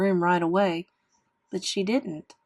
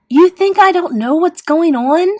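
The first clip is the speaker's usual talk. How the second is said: high, loud angry challenge; yelled; 10 semitones higher, 13 dB louder than usual. A narrator's reading. Boy pointing at his sister, yelling. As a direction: yell it as a furious challenge. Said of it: "I yelled".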